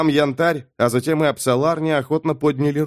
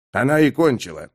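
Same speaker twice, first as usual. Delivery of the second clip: ordinary delivery, nothing marked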